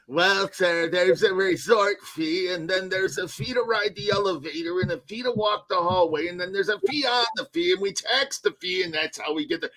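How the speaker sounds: a hint of a smug clerk reeling off fees; lightly voiced clerk listing fee after fee; mock front desk clerk. Angry guest mocking the hotel clerk, lightly smug.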